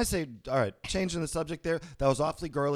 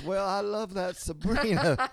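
put-on old man voice; 5 semitones higher, slower than usual. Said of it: "He goes".

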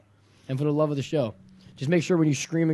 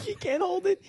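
high, breathless wheezing laugh; crying laughter; 6 dB quieter than usual. Real, not acted. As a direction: laugh until you can barely speak. Laughing helplessly at friend.

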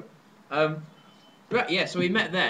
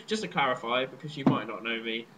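ordinary talk, nothing marked

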